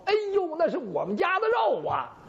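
sudden high cry of recognition, then a falling wail; startled realization. Acted. Mother-in-law realizing the dog stole her own meat, startled cry. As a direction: gasp it out as the truth dawns on her.

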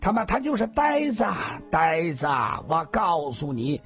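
put-on high-pitched drunken taunting; jeering drunk. A narrator's reading. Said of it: "他突然又大笑起来". (醉醺醺地高声嘲骂)

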